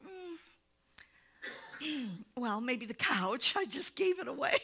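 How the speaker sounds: high, soft playful back-and-forth; sheepish confession after a throat clear; lightly voiced wife and husband; couch exchange. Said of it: "She goes".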